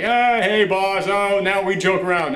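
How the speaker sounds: a hint of sudden chumminess; cheery mock greeting. Reviewer mocks the heroine's newly friendly greeting to the boss. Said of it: "she's like".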